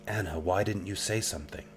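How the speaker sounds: ordinary talk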